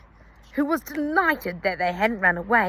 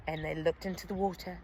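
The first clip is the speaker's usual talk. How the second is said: plain reading voice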